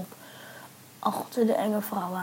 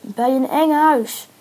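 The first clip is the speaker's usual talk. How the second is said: loud, raised shout across a distance; calling out; 4 semitones higher, 9 dB louder than usual. A narrator's reading. Friends and stranger shout back and forth, calling out. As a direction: make it carry to the other person.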